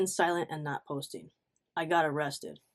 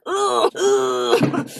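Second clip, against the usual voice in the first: high, loud laughing groan of disgust; grossed-out laugh; 11 semitones higher, 9 dB louder than usual. Real, not acted. Laughing in disgust at the creep. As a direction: cringe and laugh at once.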